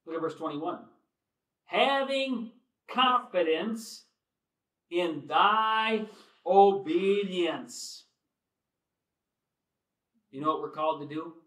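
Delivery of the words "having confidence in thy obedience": high, slow, loud scripture reading; drawn-out scripture reading. Acted. Preacher reading Paul's confidence in Philemon, slow and loud. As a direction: savour each word of the verse. Reading verse twenty-one aloud.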